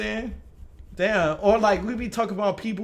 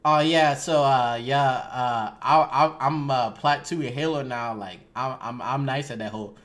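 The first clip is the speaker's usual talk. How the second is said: put-on low Louisiana accent, rambling; casual, low-pitched Louisiana talk; 6 semitones lower than usual. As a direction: let the Louisiana accent slip out. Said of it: "in my Louisiana accent said".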